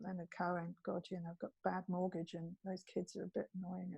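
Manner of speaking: soft mock-gloomy mumble; gloomy mutter. Audience member brooding over life, quiet and gloomy.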